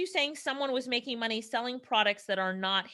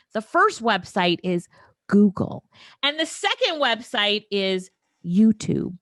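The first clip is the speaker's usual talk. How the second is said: slow, louder mock secret reveal; right in your ear; 7 dB louder than usual. Shown as a demonstration. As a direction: lean in and announce slowly. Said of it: "I'm gonna whisper this into the microphone".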